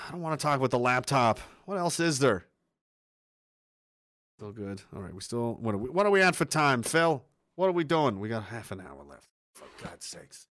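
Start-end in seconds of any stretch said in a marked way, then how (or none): none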